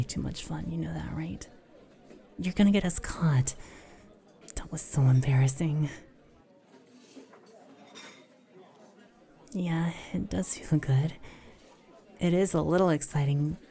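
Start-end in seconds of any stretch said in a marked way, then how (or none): 0.0-1.5 soft hushed whisper. Real, not acted. Whispering to partner in public. 4.5-11.1 whispering. Real, not acted. Whispering to partner in public.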